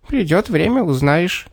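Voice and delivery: high, teasing little man; hint at a secret in a tiny voice; mysterious little man. A narrator's reading. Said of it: "промолвил человечек".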